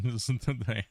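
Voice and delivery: giggling through the words; can't stop laughing. Real, not acted. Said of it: "I can't stop laughing".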